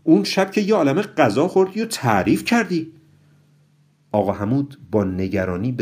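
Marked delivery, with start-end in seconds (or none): 0.0-2.9 high, loud burst of protest; sudden high-pitched "oh my!" objection; loud exclamation. A narrator's reading. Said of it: "ناگهان با اوای بلندی گفت".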